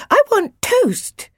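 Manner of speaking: put-on very high, drawn-out toddler voice; little boy demanding food, slow and piping; small boy. A narrator's reading. Jack heading for the kitchen, demanding in a high child's voice.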